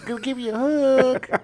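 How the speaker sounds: high goofy echo of the joke; silly high voice. Pretend. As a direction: squeak it out playfully.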